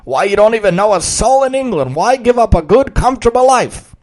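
loud, high coaxing pirate; pleading pirate. Acted. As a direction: appeal to him emotionally. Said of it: "tried to appeal to the boy's emotions".

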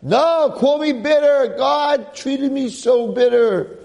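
high, whiny mock-lament, slow at the end; imitating Naomi wailing her misery; bitter complaint. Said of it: "Instead she's like".

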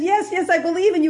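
high, fast, desperate agreement; David anxiously assures God; eager yes. Retold or imitated. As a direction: babble the yes in a hurry. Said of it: "He says".

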